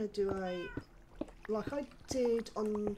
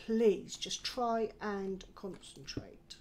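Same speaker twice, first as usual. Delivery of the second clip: ordinary delivery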